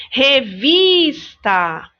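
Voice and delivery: "Revista" very slow, VIS held long; teacher's slow re-VIIIS-ta; stressed syllable. Shown as a demonstration. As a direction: stretch and punch the VIS in "Revista". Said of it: "Já dei uma dica da sílaba tônica".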